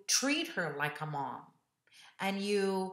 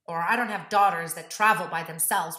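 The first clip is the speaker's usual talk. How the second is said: ordinary delivery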